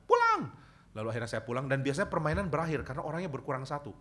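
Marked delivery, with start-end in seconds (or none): 0.1-0.5 very high, loud calling-out yell from afar; maid yelling. Retold or imitated. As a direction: call the boy home across the field. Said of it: "dia dekat dan bilang". (pembantu memanggil Jimmy pulang, teriakan tinggi dan keras)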